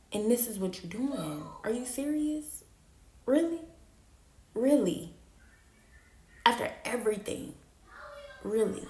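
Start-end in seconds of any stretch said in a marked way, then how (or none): 1.6-8.9 hurt disbelief. Retold or imitated. Hurt confrontation retold.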